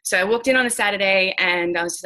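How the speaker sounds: ordinary delivery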